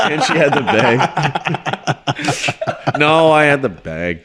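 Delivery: slow, laughing self-correction. Real, not acted. Caught out by his friend, laughing as he corrects himself. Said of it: "[name] is a masochist".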